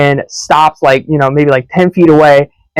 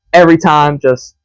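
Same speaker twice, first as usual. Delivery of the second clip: ordinary talk, nothing marked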